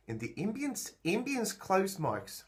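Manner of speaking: a Kiwi accent on ambience; New Zealand accent. Shown as a demonstration. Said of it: "now I'm talking with a New Zealand accent".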